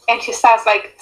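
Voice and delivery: ordinary talk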